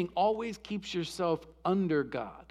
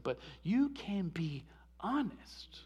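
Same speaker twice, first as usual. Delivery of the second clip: whisper; 9 dB quieter, slower than usual. Real, not acted.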